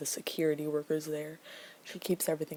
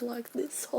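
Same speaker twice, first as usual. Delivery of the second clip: higher, badly faked British accent; poor mock British accent; 7 semitones higher, 4 dB quieter than usual. Shown as a demonstration. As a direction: attempt a British accent, hammed up. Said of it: "he has a British accent".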